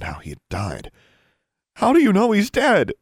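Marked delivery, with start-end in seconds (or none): 1.8-2.9 very high, loud demand; anguished shout. A narrator's reading. Alexandra challenging news of brother's death, shouting. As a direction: cry out the challenge in grief. Grieving girl challenges her questioners.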